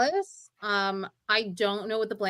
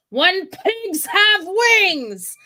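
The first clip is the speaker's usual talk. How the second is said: slow, raised dramatic delivery; theatrical Eleanor; 9 semitones higher, 8 dB louder, slower than usual. Pretend. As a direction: declaim it slowly like a stage queen. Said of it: "it's Eleanor talking to Henry".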